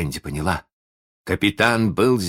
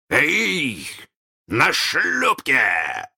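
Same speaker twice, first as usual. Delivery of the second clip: высокий, очень протяжный крик; окликни шлюпку через залив, растягивая слова; громовой оклик; 8 semitones higher, much slower than usual. A narrator's reading. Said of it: "снова прогремел голос капитана". (раскатистый крик капитана)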